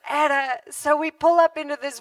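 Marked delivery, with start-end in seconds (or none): none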